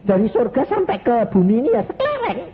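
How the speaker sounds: high, fast, marvelling; amazed. Real, not acted. Preacher marvelling at the light from heaven, high and fast. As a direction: gush in wonder at the tiny light.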